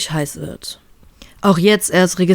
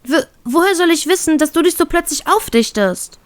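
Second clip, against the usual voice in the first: put-on girl's voice, much higher, mildly irritated stammer; 9 semitones higher than usual. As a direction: snap back defensively as the girl. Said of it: "gibt sie gereizt zurück". (gereizt, hohe Mädchenstimme)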